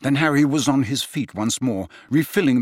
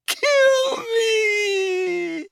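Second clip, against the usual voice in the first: very high crying shout; anguished yell. A narrator's reading. Tortured old man yelling to Harry in agony. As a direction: scream it in agony. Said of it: "he yelled".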